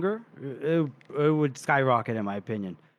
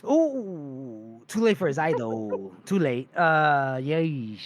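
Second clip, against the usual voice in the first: drawn-out mock sympathy, sinking lower; Nakomo Koska; 4 semitones lower, slower than usual. Mercenary looking at the injured prisoner, mock wincing. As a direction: wince theatrically, stretching the words as they drop. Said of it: "he looks at Shivers, he goes".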